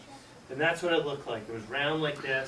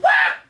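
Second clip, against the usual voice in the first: a loud sudden cry of alarm; startled shout; 13 dB louder than usual. Retold or imitated. Debbie crying out at the sight of the trap. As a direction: yelp in fright.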